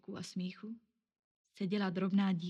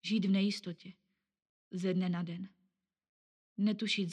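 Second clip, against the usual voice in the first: usual speaking voice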